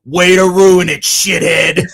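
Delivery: mock call-out. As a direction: imitate a frontman loudly calling out a fan. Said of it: "looks at me and goes".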